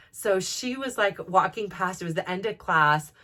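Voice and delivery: ordinary talk, nothing marked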